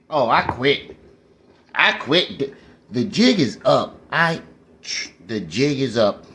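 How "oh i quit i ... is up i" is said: ordinary talk, nothing marked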